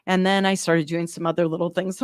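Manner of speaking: ordinary talk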